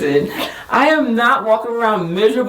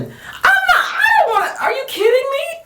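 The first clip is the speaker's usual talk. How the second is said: very high, squealed disbelief; mock disgusted squeal. Wife reacting to a germy elevator button, squealed disgust. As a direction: recoil in exaggerated horror, voice way up.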